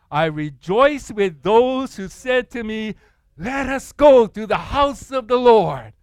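slow, loud proclaiming; loud group reading. Real, not acted. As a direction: read the psalm out together, slow and loud. Congregation reads psalm together.

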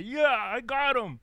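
high, loud taunting devil voice; gloating devil. Acted. Devil gloating over a caught sinner, high and loud. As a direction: crow it like the devil celebrating.

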